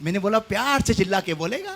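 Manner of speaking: soft coaxing. Real, not acted. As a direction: ask gently for a response. Soft first ask for an amen.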